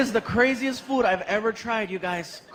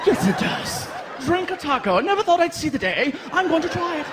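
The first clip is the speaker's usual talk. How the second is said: a hammed-up posh British gent, pitched higher; mock posh British; 6 semitones higher than usual. Foreign fans planning a Chico's trip, mock posh British. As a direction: play an eager upper-class Englishman, posh British. Brits enthusing about Chico's.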